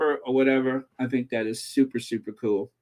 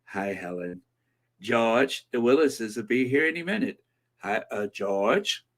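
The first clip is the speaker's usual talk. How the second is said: Louise Jefferson; 4 semitones lower than usual. Acted. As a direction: imitate a TV wife's greetings, pitched down. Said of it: "first thing I think of is Louise Jefferson".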